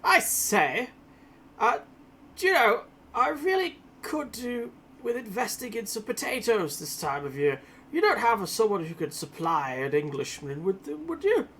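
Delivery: loud, overly posh English gent; hammy upper-class Englishman. Acted. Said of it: "with a loud, overly posh up voice".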